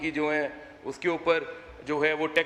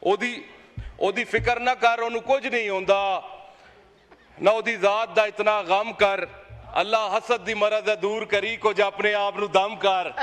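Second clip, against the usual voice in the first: declaimed verse, slightly raised and measured; reciting a Punjabi couplet; 3 semitones higher than usual. Acted. MP recites a couplet at Imran Khan's critics, declaiming. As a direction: recite it like a poet at a mushaira, a touch higher. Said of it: "मैं एक शेर पढ़ के".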